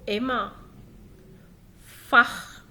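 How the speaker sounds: usual speaking voice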